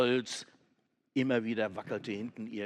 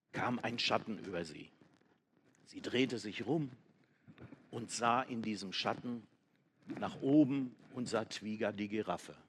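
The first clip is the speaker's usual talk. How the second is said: usual speaking voice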